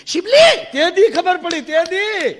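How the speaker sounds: jealous wife rails at the mirror rival; hammed-up jealous tirade; scolding wife. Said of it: "અરીસા સામે ખીજાણી".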